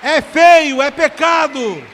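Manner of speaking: loud, high exhortation from the pulpit; full-force preaching. Real, not acted. Preacher rebuking swearing in church, shouted preaching.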